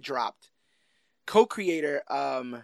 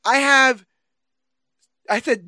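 ordinary talk

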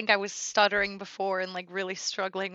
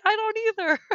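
pitched way up, sheepish; high squeaky admission; 13 semitones higher, 5 dB louder than usual. Retold or imitated. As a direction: squeak it out helplessly. Quoting herself, high.

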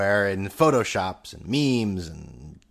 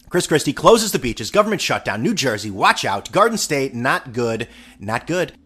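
normal talking voice

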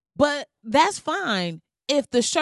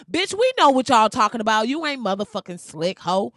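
loud, indignant clapback; angry retort; 4 dB louder than usual. Acted. Vlogger answering people excusing racism, angry. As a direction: call them out at full volume.

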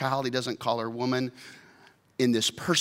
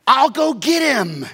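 high, loud and slow; insistent; 13 semitones higher, 11 dB louder, slower than usual. Acted. Mary insists loudly to the gardener. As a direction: plant your feet and insist.

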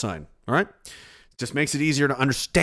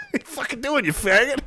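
mock taunt; 10 semitones higher, faster than usual. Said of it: "No, it meant".

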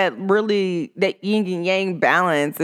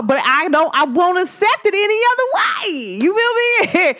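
high emphatic insistence; 11 semitones higher than usual. Real, not acted. Insisting on her own way.

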